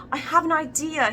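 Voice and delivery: high-pitched burst of an idea; exclaiming. A narrator's reading. Character exclaims after having a brainstorm, excited exclaiming. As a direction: exclaim it brightly with a lifted pitch. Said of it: "he exclaimed".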